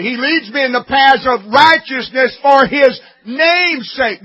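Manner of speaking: loud, driving preacher's voice; fiery sermon delivery; full-force preaching. Acted. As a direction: hammer it home from the pulpit. Preacher building to shouting.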